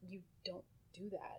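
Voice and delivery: hushed retold warning; under her breath. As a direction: mouth it almost silently. Retold reaction to woman, under breath.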